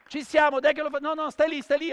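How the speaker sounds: loud, high showman's call; rally the crowd and direct them loudly. Real, not acted. Street performer rallying audience before stunt.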